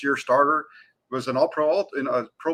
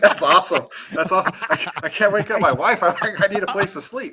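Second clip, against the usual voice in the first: higher voice, laughing through the joke; laughing mock quote; 7 semitones higher, 6 dB louder than usual. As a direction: play the caller's excuse while cracking up. Joking imitation of the whispering caller.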